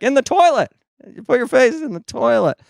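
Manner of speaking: very high-pitched caricature of the therapist; mock chirpy instruction. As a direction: squeak the answer as the therapist. Therapist answers in acted exchange.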